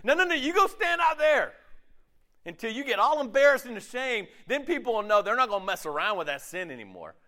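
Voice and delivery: a preachy church sending the sinner away; scolding church. Retold or imitated.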